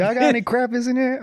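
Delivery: high-pitched exaggerated Southern twang; Southern US accent. Mimicking the Southern family at the crepe place, Southern accent. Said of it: "another Southern family that walked in".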